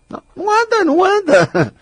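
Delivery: high whiny mocking repeat; sing-song mock whine. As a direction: mimic a whining complainer. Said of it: "repeated in mock voice".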